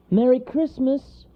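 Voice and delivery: high, loud, drawn-out call; calling after. Acted. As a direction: call it after him joyfully. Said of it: "the mother squirrel called after him".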